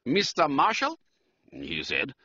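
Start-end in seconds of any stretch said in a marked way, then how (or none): 0.1-1.0 higher-pitched German count; German-accented aristocrat, pitched up. A narrator's reading. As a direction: address him formally in a German accent. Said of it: "He spoke with a German accent".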